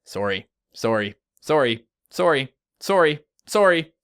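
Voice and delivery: "sorry" said one at a time with gaps, building in pitch and volume; separated sorries. Shown as a demonstration. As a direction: space out each "sorry", rising step by step. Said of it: "listen to you say sorry all day".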